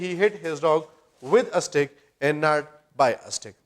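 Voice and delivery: very slow, every word spaced out; slow model sentence. Shown as a demonstration. Teacher slowly contrasting the correct sentence with the wrong one, very slow. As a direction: spell out the right and wrong versions slowly. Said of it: "That is why we say".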